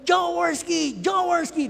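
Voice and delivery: high-pitched chant from the bench; acted, high sports-crowd yell; fan's cheer. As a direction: call out the player's name like a fan. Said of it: "sisigaw ka talaga".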